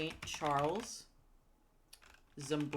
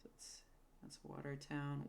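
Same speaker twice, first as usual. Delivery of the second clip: whispered to herself, remembering; a breathy whisper, just recalled it; a half-whispered aside to herself; 11 dB quieter than usual. Real, not acted. Remembering the missed town name.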